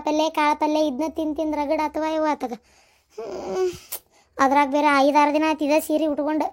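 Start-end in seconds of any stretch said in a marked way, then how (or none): 3.1-3.7 soft tearful sob; a small choked sob. Acted. Sad lament about her plight.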